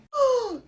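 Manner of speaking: sharp high gasp of outrage; offended gasp. Real, not acted. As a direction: gasp at the insult. Offended by Tina comparison.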